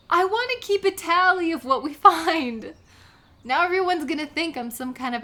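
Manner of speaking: loud, high, laughing through it. Acted. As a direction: giggle while explaining the tally. Laughing about writing nunya.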